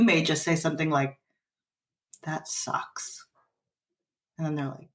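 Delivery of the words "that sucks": flat muttered reply. Shown as a demonstration. Parent's curt reply, demoed.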